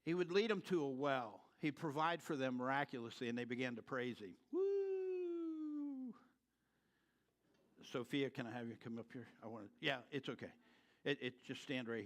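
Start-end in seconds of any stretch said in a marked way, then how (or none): 4.5-6.1 high whooping cheer; joyful whoop. Acted. Israelites cheering at a new well, joyful whoop. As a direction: whoop like the crowd praising.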